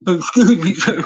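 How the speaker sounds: cracking up while parroting the beggar; mocking laugh. Speaker mocks the wheelchair scammer's plea, laughing.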